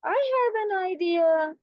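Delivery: high, put-on fox auntie; high-pitched fox with an idea. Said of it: "the Fox auntie comes forward".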